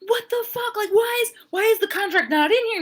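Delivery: high, loud indignant squawk; hammed-up furious demand; mock outrage. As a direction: pitch it way up in acted disbelief. Acting Sasha's instant outrage.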